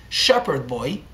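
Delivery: ordinary delivery, nothing marked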